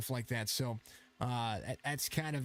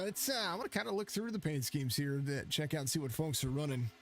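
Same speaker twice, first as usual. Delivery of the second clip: normal talking voice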